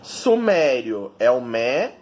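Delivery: "Sumério" slowly called out, MÉ stretched; stress on MÉ. Shown as a demonstration. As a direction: draw out the MÉ in "Sumério". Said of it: "descobrir qual é a sílaba tônica".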